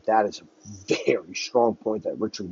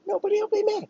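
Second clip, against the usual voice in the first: high falsetto coo, much higher; cooing parent; faster than usual. Pretend. Parent cooing to a frightened child, falsetto. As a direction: sing it sweetly like to a toddler. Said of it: "Just like a child with their parent".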